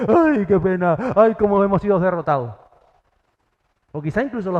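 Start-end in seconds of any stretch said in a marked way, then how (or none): 0.1-2.5 mock wailing. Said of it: "hacer el paripé".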